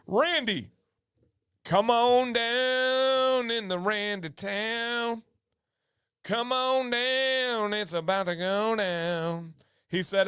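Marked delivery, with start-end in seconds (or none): 0.1-7.7 slow, stretched, higher call-up; game show host. Acted. Calling a winner up.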